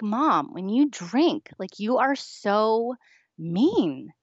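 put-on high, loud pleading teen voice. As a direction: beg her to hear how mean she is.

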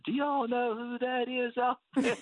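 high, drawn-out imitation of proud dad; imitating dad hollering. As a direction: call it out high and slow, as Dad. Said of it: "my dad lifts his head off that stretcher".

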